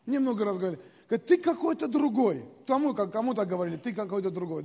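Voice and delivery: usual speaking voice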